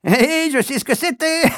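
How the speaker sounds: voix aiguë de fillette, pouffant de rire; fillette hilare. A narrator's reading. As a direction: parle entre deux éclats de rire. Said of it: "riant à gorge déployée". (la petite sœur nargue les amoureux en riant)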